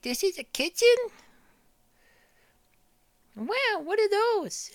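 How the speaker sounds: put-on very high, slow, wondering baby voice; baby in awe. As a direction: marvel like a tiny creature. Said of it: "he's like".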